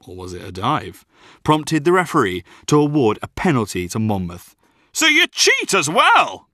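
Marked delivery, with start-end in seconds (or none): 4.9-6.5 high, drawn-out heckle; outraged bellow. Acted. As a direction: bellow it slowly across the pitch. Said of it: "bellowed my dad".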